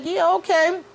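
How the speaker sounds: high put-on "yeah, okay", unimpressed; mock dismissive manager. R J Daniels brushing off Shauna, high mock-bored voice. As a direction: pitch it up and brush her off.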